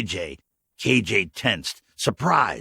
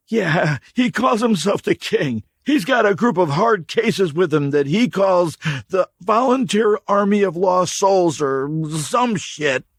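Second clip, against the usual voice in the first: high, loud, giving in; cornered drifter; 11 semitones higher, 5 dB louder than usual. Acted. Stranger caving under the rebar, hurried. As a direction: give up the name in a hurry. Dirty man's voice.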